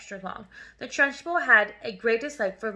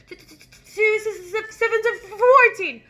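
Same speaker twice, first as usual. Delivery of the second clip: high, louder terrified stammer; stammering gasp; 10 semitones higher, 7 dB louder than usual. Acted. Hair-pulled boy gasping the answer, high terrified stammer. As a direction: stutter it out in panic.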